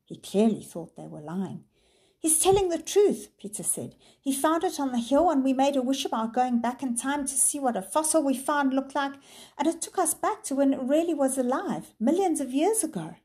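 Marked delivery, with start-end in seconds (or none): 2.2-13.1 urgent. A narrator's reading. Pleading boy.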